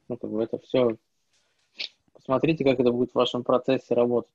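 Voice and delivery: normal talking voice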